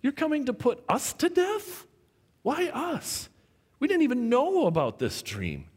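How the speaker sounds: high-pitched, disbelieving plea; imagine innocent men protesting a death sentence; bewildered captives. Acted. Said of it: "I can imagine Daniel and Shadrach".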